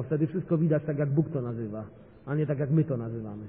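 normal talking voice

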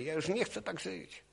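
a desperate man sighing a prayer, soft and high; retold sighing plea. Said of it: "westchnąłem właściwie".

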